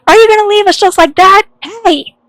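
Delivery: angry calling out. Acted. Calling after the one leaving the group, angry shouting. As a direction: yell after someone who is walking off. Yelling after someone walking away.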